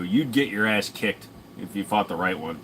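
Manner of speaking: ordinary talk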